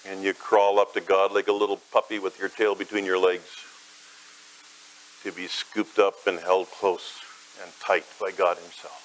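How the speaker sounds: tender sermon visualization. Acted. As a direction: lead the listener gently into peace. Gentle guided imagery of grace.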